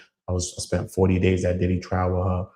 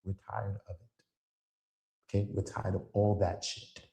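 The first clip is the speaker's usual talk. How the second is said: weary and soft; 9 dB quieter, slower than usual. Real, not acted. Weary after rant.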